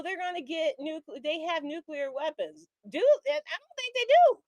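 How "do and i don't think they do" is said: high, louder disbelieving aside; incredulous jab. Real, not acted. Incredulous rant about Iran.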